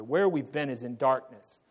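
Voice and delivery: ordinary talk, nothing marked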